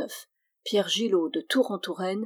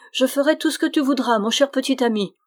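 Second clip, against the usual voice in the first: fast, high, louder begging; panicked pleading; 5 semitones higher, 5 dB louder, faster than usual. A narrator's reading. Terrified friar giving in to the goblin, panicked. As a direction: promise anything in a panic. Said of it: "le frère Bruno affolé".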